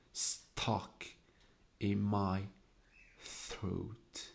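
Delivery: half-whispered, drawn-out reading of the line; breathy hushed reading. Shown as a demonstration. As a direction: hush it, let each word catch in the throat. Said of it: "make the sound harsher".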